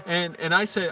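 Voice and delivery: normal talking voice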